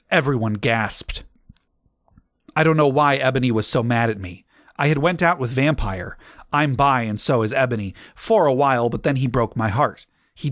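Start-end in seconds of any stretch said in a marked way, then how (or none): none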